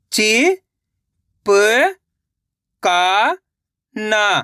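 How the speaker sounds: "चिपकाना" very slowly, syllable by syllable; sounding out slowly. Shown as a demonstration. Teacher showing children how to sound out a word. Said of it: "एक एक अक्षर का उच्चारण करके".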